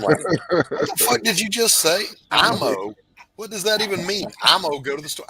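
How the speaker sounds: high, loud incredulous outburst; incredulous disbelief. Retold or imitated. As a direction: sound baffled and appalled. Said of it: "I'm like".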